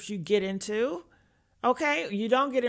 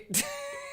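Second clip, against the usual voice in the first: shrieking laugh; 10 dB quieter than usual. Real, not acted.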